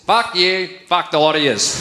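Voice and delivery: blunt Australian send-off, drawn from everyday speech; Australian slang. Shown as a demonstration. Comic translates an Australian sign for the audience, blunt Australian. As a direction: say it as a broad Australian insult. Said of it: "a phrase that is only used in the Australian spoken language".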